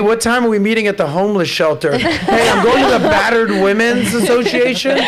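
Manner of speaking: playing the lying boyfriend's alibi texts; mock innocent boyfriend. Guy mocking a cheating boyfriend's excuses, hammed-up innocent.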